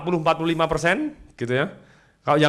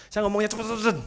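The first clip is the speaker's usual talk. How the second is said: higher, hurried repeated words; fast talking; 4 semitones higher, faster than usual. Shown as a demonstration. Speaker recalling his own quick talk, hurried. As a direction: rattle it off to show quick speech. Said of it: "Kecepatan suara sangat berpengaruh".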